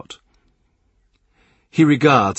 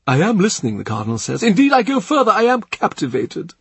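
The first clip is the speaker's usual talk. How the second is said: the Cardinal's offer; 10 semitones higher than usual. A narrator's reading. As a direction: ask like a generous prince. Cardinal's playful attention.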